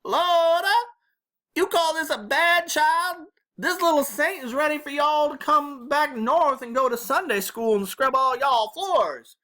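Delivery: put-on high, loud Southern old-woman holler; shouting grandma. A narrator's reading. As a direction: holler down the phone so they pull it from their ears. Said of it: "hold the phone away from her ears while she shouted".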